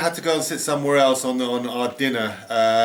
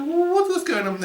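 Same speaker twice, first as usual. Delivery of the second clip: surprised squeak. Retold or imitated. Asking what is going on.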